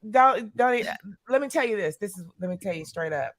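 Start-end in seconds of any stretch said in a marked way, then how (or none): none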